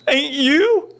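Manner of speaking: high, bewildered soldier voice; dazed soldier. Retold or imitated. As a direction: play the spaced-out grunt who thinks you're in charge. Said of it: "And the guy goes".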